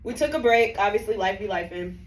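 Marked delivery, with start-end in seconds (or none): none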